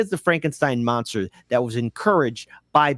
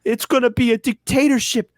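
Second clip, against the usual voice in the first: much higher, louder, slow whiny panic; mock liberal panic; 9 semitones higher, 4 dB louder, slower than usual. Mocking a fearful liberal's warning, high and whiny. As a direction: ham up the hysterical liberal. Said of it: "liberals who say".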